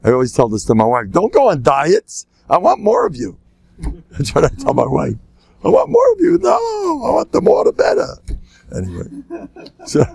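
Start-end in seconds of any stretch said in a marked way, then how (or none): 1.1-3.4 mock pleading. Husband begging his wife not to diet, mock pleading. Plea he always makes to wife. 5.6-8.2 very high, comic wailing plea; mock pleading. Husband telling his wife he wants more, hammed-up pleading.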